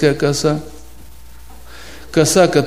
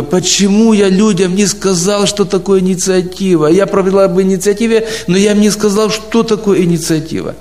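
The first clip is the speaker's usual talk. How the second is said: higher-pitched, exasperated retold thought; self-reproach; 5 semitones higher than usual. Preacher recalling his regret at not explaining a word, self-reproachful. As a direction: scold yourself in a raised pitch.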